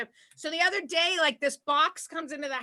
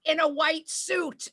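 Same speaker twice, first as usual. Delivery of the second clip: high, slow mock exasperation; drawn-out, incredulous retort; exasperated wife; 5 semitones higher, slower than usual. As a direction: spell it out to a clueless husband. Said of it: "I go".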